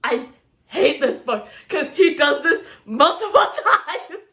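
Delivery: mock complaint through laughter; giddy laughing grumble. Real, not acted. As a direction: laugh while protesting.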